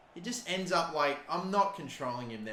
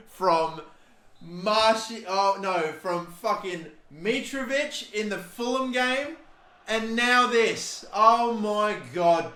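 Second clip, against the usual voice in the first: higher, slow, dragged-out despair; anguished groan; 6 semitones higher, 6 dB louder, slower than usual. Real, not acted. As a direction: stretch it out in anguish. Another late goal conceded.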